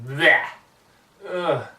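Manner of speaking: loud disgusted spitting out; sputtering spit. A narrator's reading. Pony spitting out a raw fish, loud disgusted sputter. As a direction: spit and gag at the taste.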